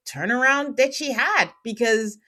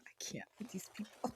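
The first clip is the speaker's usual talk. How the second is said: whispered aside; 25 dB quieter, faster than usual. Real, not acted. Host gives up on the couple, whispering. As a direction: breathe it in a whisper.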